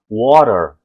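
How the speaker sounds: American "water" with flapped t, "wa-rer"; North American accent. Shown as a demonstration. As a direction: say "water" the US-Canadian way, t like a tap. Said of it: "se for um americano, um canadense".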